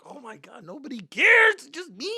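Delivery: high-pitched, rising frustration; exasperated disbelief. Retold or imitated. Son seeing his siblings don't care, exasperated. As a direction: burst out as the only one who cares. Son realizes he's alone in caring.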